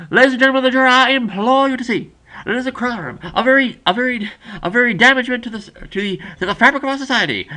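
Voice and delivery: high, loud mock Southern oratory; impassioned Southern lawyer. Friend playing a lawyer addressing the jury, impassioned Southern. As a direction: implore the jury with grand Southern conviction. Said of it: "just a Southern lawyer, just to the gr-, the greatness of his conviction".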